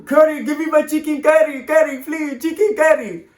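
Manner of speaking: put-on high, far-off caricatured Indian accent; sneering parody of an Indian accent; mocking high-pitched Indian accent voice.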